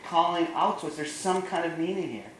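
high, urgent pleading with his brother; frustrated pleading. Retold or imitated. Jeff pleads with Pat to see the meaning, frustrated and high. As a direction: beg him to see it. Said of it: "Jeff gets super frustrated".

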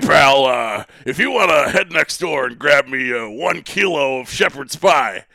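hammed-up wrestling boss impression; mock boss, pushing out the words; Vince McMahon. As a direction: put on a big promoter voice to ask a favor. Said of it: "He's Vince McMahon in his text messages".

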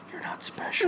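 soft, voiceless whisper; hushed teasing whisper to a sleeping baby; mock whisper. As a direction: whisper it as if into a crib. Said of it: "you're whispering into our daughter's crib".

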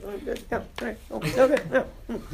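high, mumbly half-American noises; swallowed words. Acted. Embarrassed actor at an audition avoiding talk, swallowed words. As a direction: swallow the words into little noncommittal sounds. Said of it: "don't talk much and swallow my words".

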